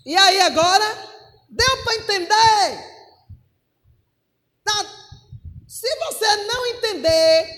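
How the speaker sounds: loud, high preacher's cry; forceful preaching. Real, not acted. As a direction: challenge the congregation at full voice.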